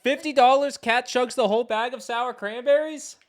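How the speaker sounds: loud, high teasing challenge; excited. Real, not acted. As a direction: call it out with glee. Excited charity challenge call.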